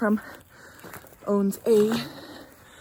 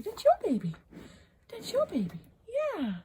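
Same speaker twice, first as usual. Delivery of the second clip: much higher coaxing to the cow; tender coaxing; 13 semitones higher than usual. Real, not acted. Urging the cow to take the calf, high coaxing. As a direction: coax her gently toward her calf.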